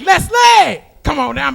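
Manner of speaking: high, loud shouted summons; game show announcer. Acted. Announcer calling a Price is Right contestant, excited shout. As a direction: call the contestant down with big hype. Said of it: "They're gonna be like".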